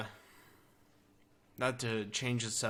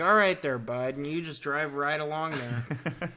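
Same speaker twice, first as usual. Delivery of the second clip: raised, louder friendly Canadian; Canadian accent; 4 semitones higher, 6 dB louder than usual. Acted. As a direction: put on a folksy Canadian accent. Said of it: "have to have the right voice".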